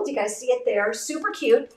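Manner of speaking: ordinary talk, nothing marked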